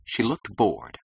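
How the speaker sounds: ordinary delivery, nothing marked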